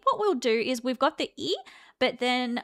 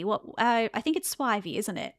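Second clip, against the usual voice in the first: normal talking voice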